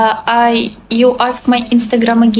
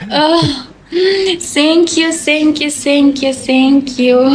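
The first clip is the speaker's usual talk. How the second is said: laughing thanks; 4 semitones higher, slower than usual. Real, not acted. Laughing after a viewer comment.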